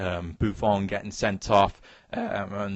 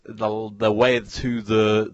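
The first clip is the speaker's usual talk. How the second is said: usual speaking voice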